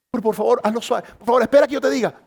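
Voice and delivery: pleading wife. Retold or imitated. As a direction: beg him to go gently and wait. Wife pleading about eye drops.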